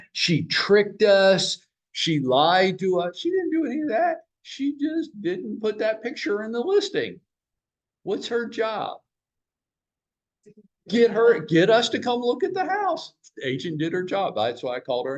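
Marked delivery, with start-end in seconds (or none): 0.1-7.2 mimicking the angry wife. Husband mocks his wife's complaint about the agent, high whiny voice. 8.0-13.1 mock incredulous. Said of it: "answers in high mock voice".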